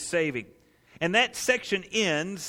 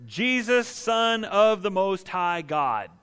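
raised, higher cry building to a slow finish; proclaimed loudly, as a man crying out; 3 semitones higher, 4 dB louder than usual. Acted. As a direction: cry it out, stretching the final title. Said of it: "said in a loud voice".

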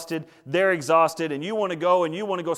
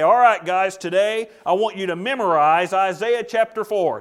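mock classroom call; 3 semitones higher, 8 dB louder than usual. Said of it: "He didn't just sit down and say".

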